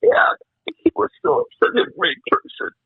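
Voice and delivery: high, breaking, tearful voice; real grief, voice giving out; crying. Real, not acted. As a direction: squeeze the words out through real sobs. Crying about his dead friend.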